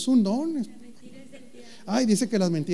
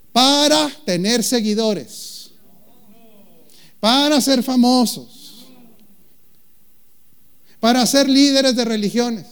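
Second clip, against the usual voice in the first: louder, higher, slow preaching build; emphatic preaching; 7 semitones higher, 8 dB louder, slower than usual. Acted. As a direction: hammer each phrase home. Predicador enumera con énfasis. (predicando con énfasis creciente)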